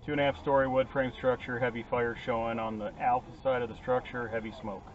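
distant radio fire report; urgent firefighter radio. Real, not acted. Chief's fire size-up over radio.

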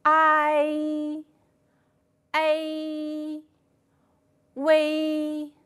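each of I, A, 微 held long and level; drawn-out diphthong drill. Shown as a demonstration. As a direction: model the three finals one by one. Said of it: "再来读读这三个复韵母吧".

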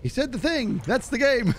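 high-pitched mock fan excitement; playful high-voiced quote of a husband; imitating excited husband. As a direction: act out a viewer thrilled at hearing the word. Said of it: "he goes".

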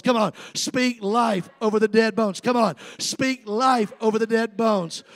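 loud, rousing sermon with rising shouts; full-force preaching. Real, not acted. Preacher rousing the church to call out revival, loud and fervent. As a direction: whip the congregation up with every call.

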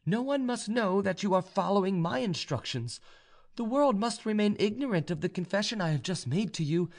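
high, deliberate feminine voice; proud young noblewoman. A narrator's reading. Vaninka gives her suitor strict conditions, high and firm. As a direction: lay down the terms in a high voice.

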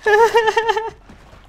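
loud high-pitched cackle; high cackling laugh. Real, not acted. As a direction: burst into a shrill cackle. Laughs after taunting enemy.

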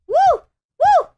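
high, loud woo; real delighted whoops; gleeful whoops. Real, not acted. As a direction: whoop on the drop. Ride down the level.

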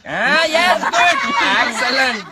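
exaggerated teacherly delight; delighted praise. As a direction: cheer the pupil's success. Teacher delighted pupil got it.

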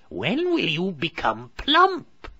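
high, slow, loud whine; a hungry hag nagging; impatient witch. A narrator's reading. Witch waiting for plump Hansel, slow and whining. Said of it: "she complained".

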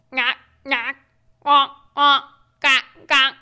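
lightly voiced little duck quacks; a hint of small ducks calling; small ducks quacking. A narrator's reading. Reading to a child, light quacks of the small ducks. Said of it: "waggle their tails and quack".

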